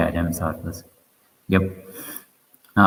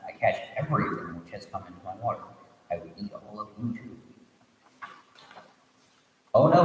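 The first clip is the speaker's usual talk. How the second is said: steady storytelling read, voicing the characters lightly; story narration; 4 semitones higher than usual. A narrator's reading. Teacher reading a Buddhist tale to students, storytelling voice. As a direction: read the tale aloud to the class.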